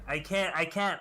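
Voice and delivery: normal talking voice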